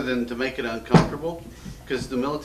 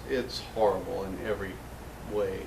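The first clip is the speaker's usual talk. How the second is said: ordinary delivery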